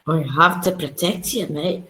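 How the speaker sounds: put-on Scottish accent, better done; Scottish lilt, teasing the friend. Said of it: "you do it much better".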